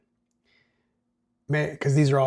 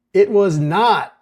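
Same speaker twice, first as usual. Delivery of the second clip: "not" punched high and loud; stress on not; 8 semitones higher, 14 dB louder, slower than usual. Acted. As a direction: lean hard on "not", as if underlined.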